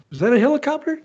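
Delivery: high, alarmed question; startled question. Retold or imitated. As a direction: mimic a friend suddenly startled. Butch startled by the sound.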